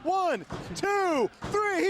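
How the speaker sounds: shouted count. Real, not acted. Ref count pinfall finish.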